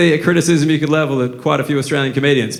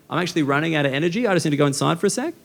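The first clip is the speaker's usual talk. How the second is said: put-on rushed child excuse; 7 dB quieter, much faster than usual. Tired niece excuses herself.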